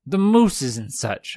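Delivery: hammed-up Canadian joke voice; mock Canadian. Joking Canadian caricature.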